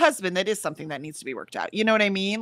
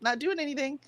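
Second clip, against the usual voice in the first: sarcastic; 6 semitones higher than usual. Pretend. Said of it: "That's what I would be like".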